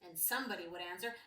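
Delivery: ordinary delivery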